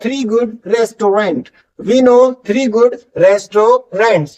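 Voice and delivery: know said very slowly with silent k; drawn out word by word; slow careful demo. Shown as a demonstration. Teacher models silent k, very slowly. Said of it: "no में के नहीं बोलता".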